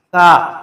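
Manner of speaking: تا said with the tongue tip on the front teeth; تا said clearly from its own articulation point; correct ta sound. Shown as a demonstration. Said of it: "تو یہ اس کا صحیح تلفظ ہے". (زبان کی نوک سامنے کے دانتوں سے لگا کر تا)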